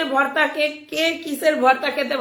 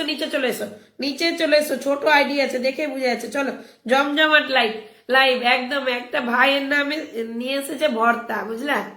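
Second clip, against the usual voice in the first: normal talking voice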